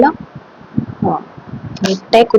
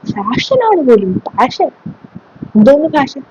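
mocking scorn; 6 semitones higher, slower than usual. Teacher mocks pupils' fashion excuse.

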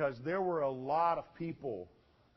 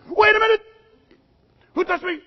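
sudden, high-pitched loud outburst; startled shout; 14 dB louder, faster than usual. Acted. Jesus halting the crowd to find who touched him, startled shout. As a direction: shout it out to halt everyone in their tracks.

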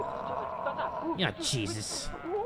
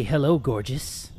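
lower, soft, slowed flirt; mock sweet talk; 6 semitones lower, 5 dB quieter, slower than usual. A gamer sweet-talking his houseplant in a low voice. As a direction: charm the plant.

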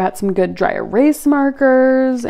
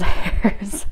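ordinary talk

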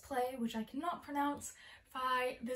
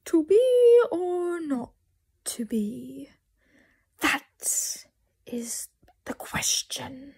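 hammed-up Shakespearean actor; 3 semitones higher, 12 dB louder, slower than usual. Actor clowning with a Hamlet line after signing off, hammed-up. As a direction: overact it hugely, stretching the words out.

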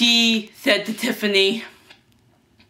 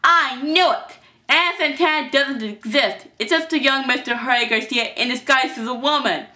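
higher, loud exposure of an impostor; triumphant accusation; 6 semitones higher, 3 dB louder than usual. Acted. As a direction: expose the disguise with force. Villain angrily exposes the disguise.

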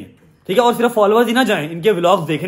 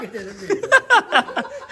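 high-pitched burst of laughter at the reveal; 12 semitones higher than usual. Real, not acted. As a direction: let out a sudden high laugh. (लड़के की girlfriend का राज़ खुलने पर ऊँची हँसी)

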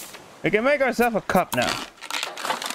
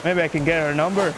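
usual speaking voice

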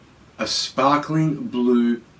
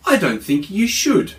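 a touch higher and louder, small but brave; brave little one; 8 semitones higher, 5 dB louder than usual. Acted. Little Gruff bravely answering the Yowie, a bit higher. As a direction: stand up to the monster with a small voice. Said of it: "said that brave Little Gruff".

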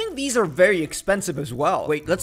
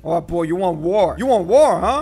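mock threat; 6 dB louder than usual. Real, not acted. Taunting fish.

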